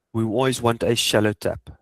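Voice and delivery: usual speaking voice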